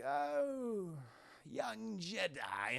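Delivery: slow, soft sinister voice; tempting devilish whisper. Acted. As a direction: drawl it like an evil emperor. Palpatine speaks again.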